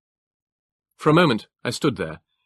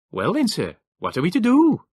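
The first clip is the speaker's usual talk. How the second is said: clipped northeast English trooper, rising higher and louder; northeast England accent; 10 semitones higher, 5 dB louder than usual. A narrator's reading. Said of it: "the clipped, Teutonic accent of the northeast of England".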